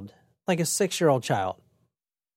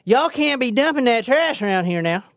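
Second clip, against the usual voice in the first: put-on higher-pitched Southern accent; 6 semitones higher than usual. Truck driver warning people off dumping, Southern accent. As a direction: call out in a folksy Southern accent. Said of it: "It's not the South".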